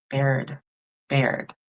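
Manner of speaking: "Baird" with a long ay vowel; "Baird" rhyming with cared; Baird pronunciation. Shown as a demonstration. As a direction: say "Baird", ay as in pair. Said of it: "it sounds like Baird".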